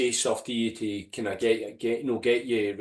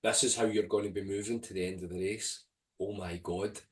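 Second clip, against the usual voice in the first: despairing thought; 3 semitones lower, 8 dB quieter than usual. Retold or imitated. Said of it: "all I could think was".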